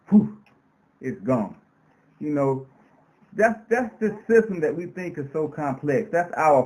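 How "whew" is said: a quick puff of air blown out; blowing breath. Shown as a demonstration. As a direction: blow it away in one breath. Demonstrating blowing away spider web.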